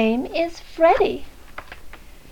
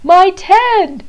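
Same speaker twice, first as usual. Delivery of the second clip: gasped; 3 semitones higher, 7 dB louder than usual. Acted. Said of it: "gasped the bear".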